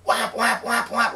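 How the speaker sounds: high, repetitive alarm imitation; blaring alarm. Alarm going off in sleeper's ear, imitated blare. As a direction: honk each word like an alarm clock. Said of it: "the alarm's like".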